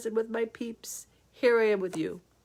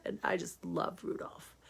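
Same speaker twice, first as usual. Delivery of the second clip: whispering; 12 dB quieter than usual. Real, not acted. Softly confides her love.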